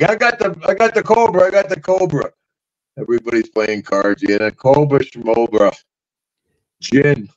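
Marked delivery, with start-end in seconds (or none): 0.0-2.3 high, excited call; high excited snake handler; eager announcement. Retold or imitated. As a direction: show off the snake proudly. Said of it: "Albert comes in".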